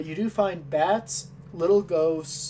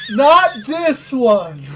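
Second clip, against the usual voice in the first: slow, louder not this one; slow pointed refusal; 5 semitones higher, 7 dB louder, much slower than usual. Acted. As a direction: lay it down pointedly. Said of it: "stops mid-sentence and says".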